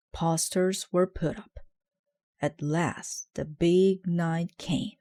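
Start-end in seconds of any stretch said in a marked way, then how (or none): none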